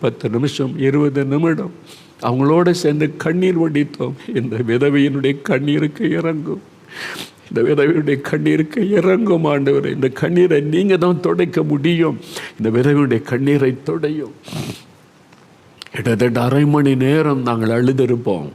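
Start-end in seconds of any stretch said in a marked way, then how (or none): 4.4-14.3 fervent prayer, voice raised a little; pleading prayer. Retold or imitated. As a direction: beg God earnestly.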